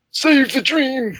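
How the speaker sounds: high, slow gravelly Saw Gerrera impression. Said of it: "use his voice".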